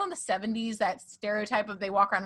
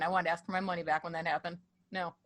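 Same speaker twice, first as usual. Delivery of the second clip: normal talking voice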